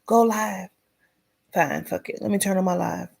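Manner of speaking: normal talking voice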